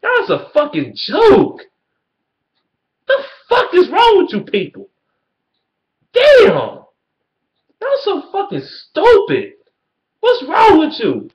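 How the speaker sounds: very high, strained furious yell, slow; shrieking rage. Real, not acted. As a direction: scream it in disbelief at them. Furious rant at rival fans.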